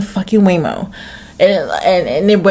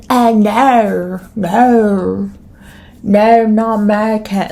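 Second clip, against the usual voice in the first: badly faked foreign accent, failing to hide being American; botched foreign accent; much slower than usual. American denying it at a bar abroad, botched foreign accent. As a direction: attempt at a non-American accent. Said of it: "I can't even do a fucking accent".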